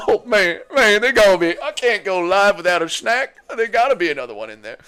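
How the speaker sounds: mock whiny snack hunter; DC impression. Streamer imitating DC hunting for Tim Tams, light mock whine. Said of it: "Impression of D C rooting around the fridge".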